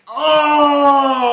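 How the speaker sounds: huge high shout to the sky; mock battle roar. Mocking the movie's heroes with a sky roar. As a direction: roar it out like a barbarian lifting a trophy. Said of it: "just go like".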